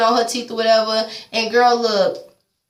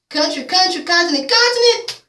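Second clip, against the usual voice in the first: climbing higher in disbelief; incredulous; 9 semitones higher than usual. Real, not acted. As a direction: let each repeat rise in pitch, incredulous. Incredulous about long-distance love.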